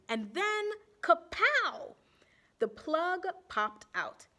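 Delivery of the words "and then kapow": dramatic kapow. A narrator's reading. Story climax sound word.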